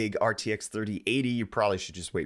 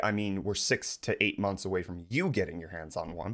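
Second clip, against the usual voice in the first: ordinary delivery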